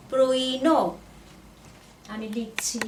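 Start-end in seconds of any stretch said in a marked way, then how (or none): 0.1-0.8 প্রইনো loud and clear, ending on a rise; careful sound demonstration. Shown as a demonstration. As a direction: model প্রইনো for learners, louder, rising at the end. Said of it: "আপনারা শুনবেন ভালো করে সাউন্ডটা".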